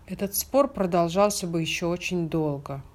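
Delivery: plain reading voice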